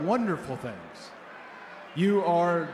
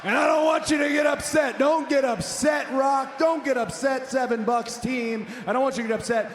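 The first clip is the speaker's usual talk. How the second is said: mocking shout; 5 semitones higher than usual. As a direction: shout him down with fake concern. Shouting back at the Rock.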